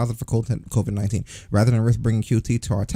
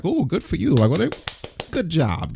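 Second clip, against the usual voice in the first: mock congratulation; condescending sarcasm; sarcastic; 6 semitones higher than usual. Mocking WWE statement.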